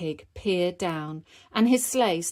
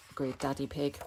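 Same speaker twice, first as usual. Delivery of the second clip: usual speaking voice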